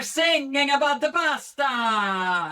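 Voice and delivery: high, loud operatic tenor flourish; Italian tenor. Shown as a demonstration. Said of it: "pretend to be Italian tenors".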